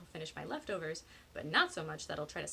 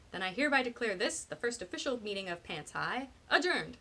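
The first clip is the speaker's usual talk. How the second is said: louder proclamation, peaking on the last word; mock-formal declaration; 3 semitones higher, 6 dB louder than usual. A narrator's reading. Penny closes the friends' meeting, mock-official. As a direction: proclaim it like a chairperson.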